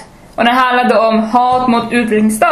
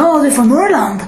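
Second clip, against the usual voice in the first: higher-pitched imitation of patronising interest; mock delight; 4 semitones higher than usual. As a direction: mimic someone finding you exotic. Mimics Stockholmers' delighted reaction.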